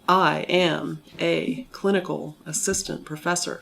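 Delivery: "I am a clinical assistant professor" with every word hit equally hard; equal stress on every word. Shown as a demonstration. Speech teacher imitating a non-native stress pattern, equal stress on every word. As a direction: punch each word of "I am a clinical assistant professor" evenly, sharp and aggressive. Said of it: "some people put equal stress on every word".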